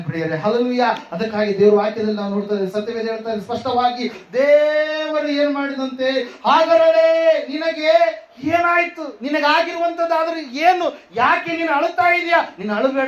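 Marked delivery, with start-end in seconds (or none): 4.3-13.1 high, loud, stretched-out proclamation; fervent preaching. Acted. Preacher voicing God asking Hagar why she weeps, impassioned preaching. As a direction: cry out God's questions to the weeping woman.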